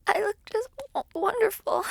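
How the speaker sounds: overwhelmed, teary voice cracking; voice breaking into tears. Acted. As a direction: pitch jumps high as she chokes up. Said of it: "Don't cry".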